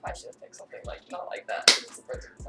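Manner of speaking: hushed aside, breath only; whispering. Real, not acted. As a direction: whisper it so the host won't hear.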